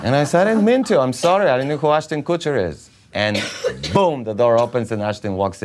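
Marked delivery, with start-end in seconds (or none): 0.5-2.9 high, hurried pleading; acted-out flustered excuse; panicked apology. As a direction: plead your innocence in a rush, pitched up. Apologising to the offended director.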